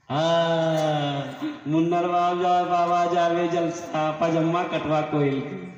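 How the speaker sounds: loud, drawn-out sing-song wifely exclamation; wife suddenly remembering. Acted. Wife recalls husband's pajama request, loud sing-song exclamation. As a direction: stretch it out slowly, half chanted.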